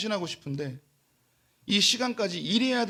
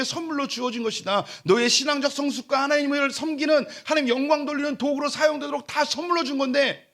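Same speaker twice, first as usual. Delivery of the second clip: raised, higher impassioned sermon; fiery preaching; 8 semitones higher, 4 dB louder than usual. Acted. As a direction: drive the point home from the pulpit. Preaching at full force. (높고 큰 소리로 열정적으로 설교하듯)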